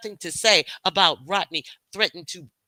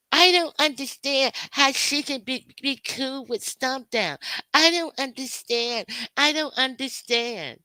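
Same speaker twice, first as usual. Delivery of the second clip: high, put-on voice of a confused hater, louder at first; mocking whiny critic; 6 semitones higher, 3 dB louder than usual. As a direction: parody her as a clueless, complaining critic.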